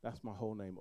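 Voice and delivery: usual speaking voice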